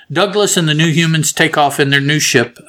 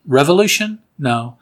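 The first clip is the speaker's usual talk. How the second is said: ordinary talk, nothing marked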